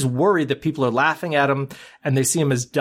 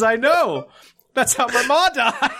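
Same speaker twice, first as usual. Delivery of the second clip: high, laughing mock voice; lightly voiced musician through laughter; deadpan punchline; 9 semitones higher than usual. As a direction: deliver the joke's punchline giggling. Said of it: "And then he says".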